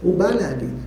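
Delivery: usual speaking voice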